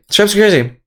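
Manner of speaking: normal talking voice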